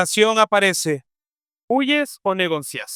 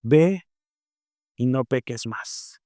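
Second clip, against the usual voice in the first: inner voice; 6 semitones lower, slower than usual. Retold or imitated. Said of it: "escucho una voz que me dice".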